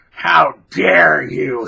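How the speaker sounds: loud, drawn-out indignation; hammed-up affronted exclamation; mock outrage. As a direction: stretch it slowly in exaggerated offense. Acts offended friend over car remark.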